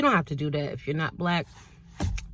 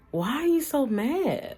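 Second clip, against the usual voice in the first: slow, raised pitch, incredulous; baffled high question; 9 semitones higher, slower than usual. Retold or imitated. Asking the offended stranger why so mad, slow and incredulous. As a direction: stretch it out, baffled by the anger. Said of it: "And I'm like".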